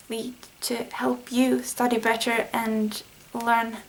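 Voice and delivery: normal talking voice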